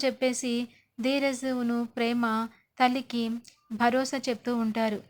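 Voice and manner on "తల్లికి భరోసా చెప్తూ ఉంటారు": usual speaking voice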